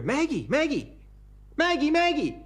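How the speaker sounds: high, loud frantic cries of a name; panicked calling. Acted. Husband over his wife's body on the floor, high frantic calls. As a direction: shake her awake, rising panic. Husband shakes dead wife.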